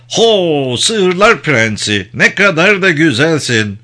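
high, drawn-out hailing of an animal; caressing praise. A narrator's reading. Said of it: "okşayıcı bir sesle".

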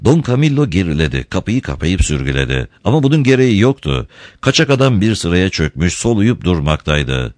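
ordinary delivery, nothing marked

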